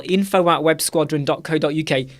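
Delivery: usual speaking voice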